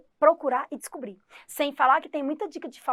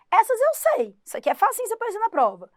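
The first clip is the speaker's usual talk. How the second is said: excited; 10 semitones higher, 6 dB louder, faster than usual. Acted. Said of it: "excited teacher line".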